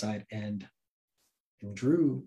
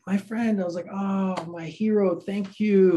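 mock gushing thanks; 7 semitones higher, 6 dB louder, slower than usual. Gushing fake thanks to the thief.

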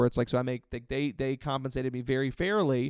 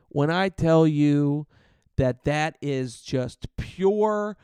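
much slower, louder, stretched out; dramatic slow build; 6 dB louder, much slower than usual. Real, not acted. Comic savours telling the crowd about refusing service. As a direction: drag it out for effect.

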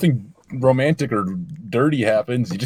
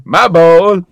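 put-on Borat accent; 5 semitones higher, 10 dB louder than usual. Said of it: "imagining Borat going bowling".

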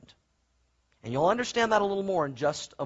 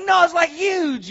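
high, loud, stretched-out exaggeration; mock-panicked high voice; imitating alarmed wife; 10 semitones higher, 6 dB louder, slower than usual. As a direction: insist like a frantic wife, pitched up. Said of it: "She's like".

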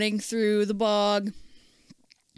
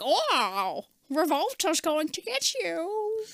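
high, drawn-out creature warning; slow singsong cartoon warning, pitched up; sea cucumber creature; 8 semitones higher, slower than usual. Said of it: "runs into the freaking sea cucumber".

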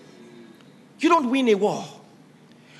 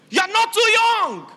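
preacher exhorting the congregation at full force; preaching shout; 13 semitones higher, 5 dB louder than usual. Real, not acted. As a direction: cry it out over the crowd.

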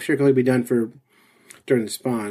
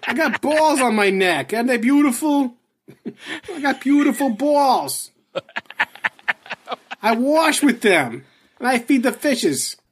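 put-on high, drawn-out New York-area accent; brash New York street character, hammed up; New Jersey accent; 10 semitones higher, slower than usual. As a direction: play a proud loudmouth with a thick Jersey accent. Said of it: "You're from New Jersey, aren't you?".

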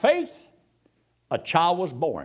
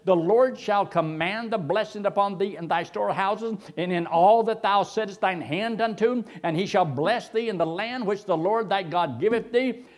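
raised, steady preacher's scripture read; 4 semitones higher than usual. Acted. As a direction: proclaim the verse to the congregation.

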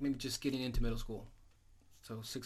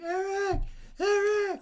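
much higher and louder, frantic calling; panicked yell; 10 dB louder, much slower than usual. Retold or imitated. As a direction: yell for help across the house. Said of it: "Marcus was like".

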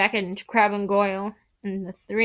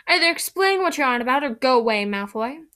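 louder, fast and testy; 4 semitones higher, 4 dB louder than usual. Acted. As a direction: bite off each word impatiently. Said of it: "said Hermione testily".

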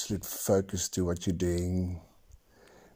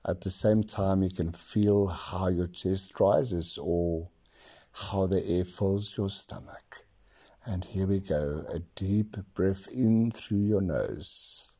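guided meditation. Acted. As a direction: lead them gently into calm. Leads a breathing meditation.

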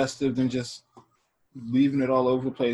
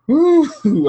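surprised whoop; 10 dB louder than usual. Real, not acted. Reacting to shocking confession.

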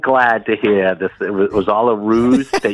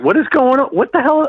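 mock outrage; 14 semitones higher than usual. Caller mock outrage, high pitch.

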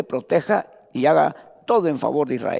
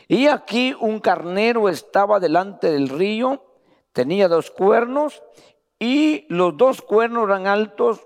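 slow, raised reading of scripture; lectura bíblica; 3 semitones higher, slower than usual. Acted. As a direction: proclaim the verses to the church. (lectura solemne de la Escritura en voz alzada)

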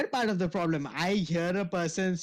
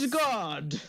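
higher, put-on heroic god voice; Thor; 4 semitones higher than usual. Co-host playing Thor for a laugh, mock-heroic cry. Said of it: "Star-Lord starts speaking like Thor".

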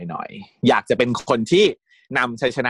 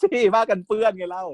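much higher excited correction; excited fangirling; 11 semitones higher than usual. Real, not acted. Correcting her friend about the kitchen scene, high excitement. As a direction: squeal the answer.

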